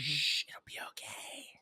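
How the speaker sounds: soft, hushed comfort; a wife hushing her weeping husband in a whisper; soothing whisper. Pretend. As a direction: whisper it like calming a crying child. Imagined comforting of a crying partner.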